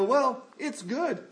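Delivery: mock-glib, high and drawn out. Preacher mimicking people who gloss over bad things, glib. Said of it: "lead us to glibly go".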